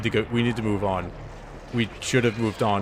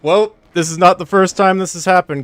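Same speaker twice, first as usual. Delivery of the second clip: angry; 8 semitones higher, 9 dB louder than usual. Real, not acted. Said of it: "So fucking mad right now".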